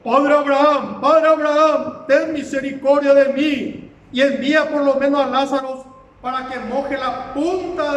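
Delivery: preacher crying out as the tormented man; desperate shouted plea. Acted. Rich man in hell begs Abraham for water, shouting. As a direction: call out loudly, begging for mercy. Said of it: "Entonces él gritando dijo".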